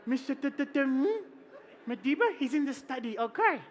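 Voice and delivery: a hint of a nervous aide fumbling the name; flustered staffer. Staffer flustered, announcing Madiba.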